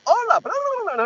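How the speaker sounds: high, eager mock chatter; high-pitched gibberish reply; Spanish-speaking shopper. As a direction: imitate a shopper launching into Spanish. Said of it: "Spanish-speaking person will turn and go".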